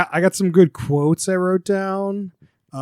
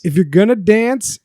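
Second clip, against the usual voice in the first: ordinary talk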